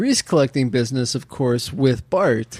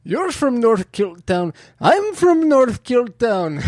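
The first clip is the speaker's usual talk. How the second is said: higher, slow fake Scottish brogue; fake Scottish accent; 8 semitones higher, slower than usual. Fan quoting Simpsons in slow fake Scottish accent. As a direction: ham up a Groundskeeper Willie Scottish accent. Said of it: "you sound like Sean Connery".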